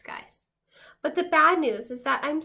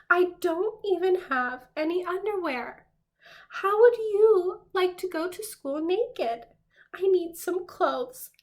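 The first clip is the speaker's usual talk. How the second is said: higher, slower, mock-distressed whine; naked crayon begging Duncan for clothes, hammed-up distress; embarrassed plea; 6 semitones higher, slower than usual. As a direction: plead like a kid caught undressed. Naked peach crayon begs for clothes.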